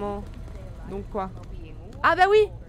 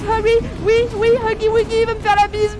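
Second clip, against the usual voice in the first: panicked; 4 semitones higher, 7 dB louder than usual. Real, not acted. Fleeing Huggy Wuggy.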